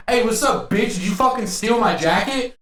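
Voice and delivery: retold yell, pitched well up; mild mock shout about the jacket; mock confrontation. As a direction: imitate an angry stranger accusing you. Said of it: "And he's like".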